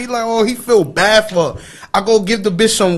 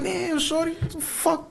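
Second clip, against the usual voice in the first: hushed aside; 7 semitones higher, 14 dB quieter than usual. Retold or imitated.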